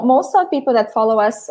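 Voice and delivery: usual speaking voice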